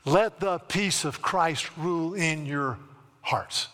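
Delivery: slow solemn scripture read. Acted. Pastor reading Colossians to congregation, slow solemn. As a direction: lay each word down slowly.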